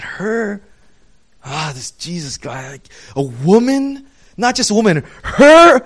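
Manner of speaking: incredulous, rising to a loud outraged "her?"; disgusted scoff. Retold or imitated. Disgusted crowd scoffing at a woman touching Jesus.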